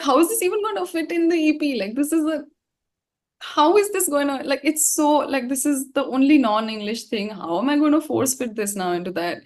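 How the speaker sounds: high, fast, worried rush of questions; panicked disbelief. Retold or imitated. Singer retelling her panic to the interviewer, high and frantic. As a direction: spill the doubts out in a frantic rush.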